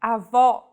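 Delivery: "Avó" stressed on the open final O, ah-VAW; open final vowel. Shown as a demonstration. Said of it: "pronounced with open pronunciation".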